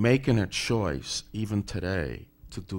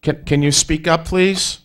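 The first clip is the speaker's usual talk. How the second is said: loud and higher, over a bad line; to someone hard of hearing; 8 semitones higher, 10 dB louder than usual. Real, not acted. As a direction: push the voice out to reach a faint caller. Asks faint caller to speak up.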